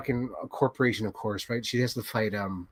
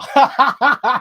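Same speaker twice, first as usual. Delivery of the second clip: loud high laugh; 10 semitones higher, 7 dB louder than usual. Real, not acted. Laughs at the inbred joke.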